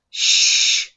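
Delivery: sharp loud hissed shh; hissed shush. Acted. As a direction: hiss a hush at him. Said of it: "hissed Professor McGonagall".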